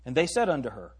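normal talking voice

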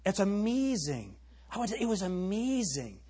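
mock the way young girls talk; a hint of a gushing teenage girl; imitating girls. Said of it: "they've been talking like this".

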